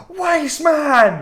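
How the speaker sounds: high, loud shout of recognition; little girl's yell. Retold or imitated. Little girl spotting him in smoking area, yelled out. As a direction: holler the nickname through a doorway. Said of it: "she just shut, opened the door".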